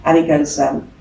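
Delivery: ordinary talk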